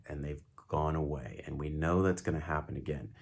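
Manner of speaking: soft, steady reassurance; calm, controlled voice. Shown as a demonstration. Doctor soothing a panicking friend, calm and controlled. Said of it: "See what I'm doing with the voice?".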